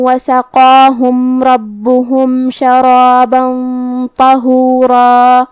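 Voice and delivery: slow, drawn-out tajweed recitation; Quran recitation. Acted. Teacher recites the verse for students, slow and melodic. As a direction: chant each word slowly and melodically.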